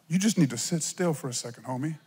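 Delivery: a soft, low, laid-back hint of God; God's calm voice. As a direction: gently tell him to chill, homie. God voiced telling him sit.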